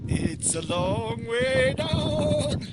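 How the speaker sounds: high, very slow, amazed; slow drawn-out awe. Real, not acted. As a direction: stretch it out in awe. Repeating the view remark, drawn out.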